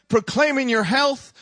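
ordinary talk, nothing marked

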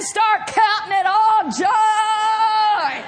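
shouted, stretched-out climax; rousing exhortation. Acted. Holy Ghost urging the worrier to rejoice, rousing shout. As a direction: draw out the last word triumphantly.

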